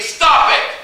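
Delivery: high, loud sharp warning; stern mom voice. Acted. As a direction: bark it like a scolding parent. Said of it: "if we could hear the tone of James's voice".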